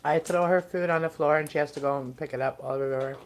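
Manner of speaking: normal talking voice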